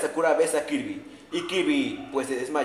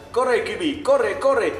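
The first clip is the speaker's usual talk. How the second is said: higher and louder, urging Kirby on; a fan cheering a character to run for the portal, urgent and loud; 7 semitones higher, 4 dB louder, much slower than usual. Acted. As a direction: yell at Kirby to hurry through.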